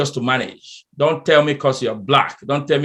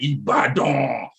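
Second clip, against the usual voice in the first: impressed reaction; 3 dB quieter, much slower than usual. Retold or imitated. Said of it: "everybody like".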